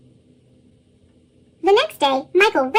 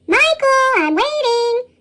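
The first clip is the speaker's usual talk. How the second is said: higher-pitched mother calling her son; 7 semitones higher, slower than usual. A narrator's reading. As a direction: call out for a child who's keeping you waiting. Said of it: "his mother called".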